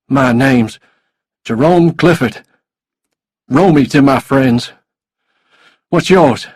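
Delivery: loud, forceful villain voice; big, pushy character voice; menacing desperate man. A narrator's reading. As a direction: introduce yourself loudly, throwing your weight about. Menacing man introduces himself to captive.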